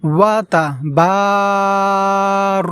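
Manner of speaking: long drawn-out ওয়া তাবার, vowel held; drawn-out Quranic recitation; elongated vowel. Shown as a demonstration. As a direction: stretch the আ in তাবার slowly. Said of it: "এইভাবে".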